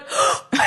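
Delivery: sharp acted intake of breath; shocked gasp. Acting out shock at herself.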